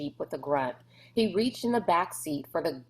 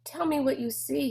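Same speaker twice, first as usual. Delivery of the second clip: crowing; 8 semitones higher than usual. A narrator's reading. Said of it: "he crowded, or crowed".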